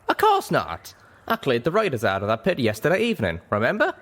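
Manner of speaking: lightly voiced cocky cowboy, quick and pleased; proud cowboy. A narrator's reading. Calamity reassuring the wounded mare, proud and breezy. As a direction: toss it off proudly. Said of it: "Calamity landed proudly".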